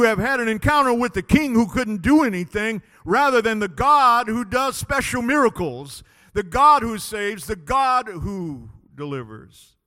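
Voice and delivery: loud, high sermon declaration; full-force preaching. Real, not acted. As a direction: preach it out, punching each God.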